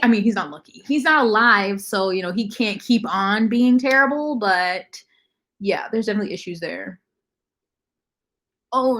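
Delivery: ordinary talk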